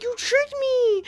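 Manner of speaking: high, stretched-out fairy whine; mock-indignant squeal; outraged fairy. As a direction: wail it slowly like a duped fairy. Fairy repeats its protest.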